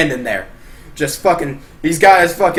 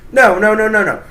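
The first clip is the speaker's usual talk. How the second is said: ordinary delivery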